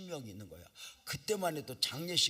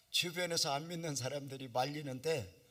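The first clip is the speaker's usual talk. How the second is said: usual speaking voice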